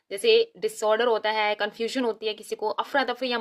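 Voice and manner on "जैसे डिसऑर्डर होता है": ordinary delivery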